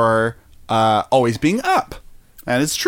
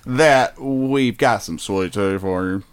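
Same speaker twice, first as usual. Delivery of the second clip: put-on southern accent; 2 semitones higher than usual. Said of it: "that's my southern accent".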